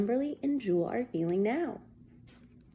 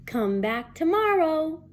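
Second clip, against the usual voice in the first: higher, slow, friendly call; teacher calling out; 6 semitones higher, 3 dB louder than usual. Acted. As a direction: send the kids off cheerfully. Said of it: "called Missus Peachum".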